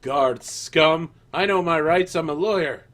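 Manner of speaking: loud, indignant protest; indignant prisoner. Acted. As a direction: demand your release, outraged. Angry prisoner demands release.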